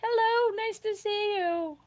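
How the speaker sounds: high, bright welcome; excited greeting. Real, not acted. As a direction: greet a friend with delight. Greeting a viewer in chat.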